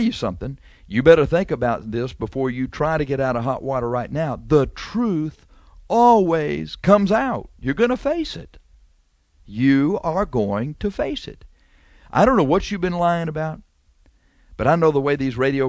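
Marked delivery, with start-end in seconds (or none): 4.5-7.5 slowed, higher and louder on always comes out; slow preacher warning. Acted. Radio preacher warns listeners the truth comes out, slow and emphatic. 7.6-8.6 much higher, pressing on face; raised warning. Acted. Preacher tells the liar he will face it, sharply higher. 9.4-11.4 slowed, each word spelled out; slow, deliberate. Acted. Preacher repeats the warning, slow and deliberate.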